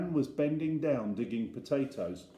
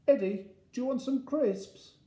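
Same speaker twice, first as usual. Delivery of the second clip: put-on high, sing-song offer to a child; coaxing parent; 13 semitones higher than usual. Dad offering Eddie picnic food, high coaxing. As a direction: offer it brightly, pitched well up.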